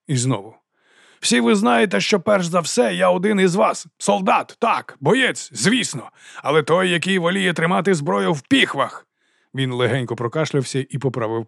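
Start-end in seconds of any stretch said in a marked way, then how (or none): 1.2-9.1 high-pitched public speech; ringing oration. A narrator's reading. Captain claims to be one of the men, ringing oratory. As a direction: declaim to the gathered soldiers. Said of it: "Його голос дзвенів".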